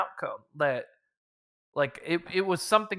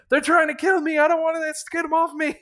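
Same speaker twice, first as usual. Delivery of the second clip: high, loud frantic pleading; panicked plea; 12 semitones higher, 7 dB louder than usual. Retold or imitated. Priest begging the Guardian to save him: high frantic plea. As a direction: beg for help in a panic.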